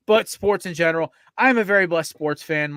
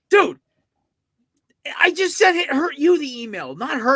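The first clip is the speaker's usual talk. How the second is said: much higher, louder urgent pleading; frantic, half-laughing relief; retold frantic plea; 10 semitones higher, 5 dB louder than usual. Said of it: "and I'm like".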